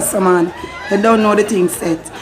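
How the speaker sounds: normal talking voice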